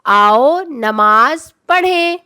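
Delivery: آؤ نماز پڑھیں spoken slowly and evenly; slow model sentence. Shown as a demonstration. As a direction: read it out slowly for the children. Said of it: "آؤ میں واو کے اوپر". (آؤ نماز پڑھیں کو آہستہ آہستہ پڑھ کر دکھانا)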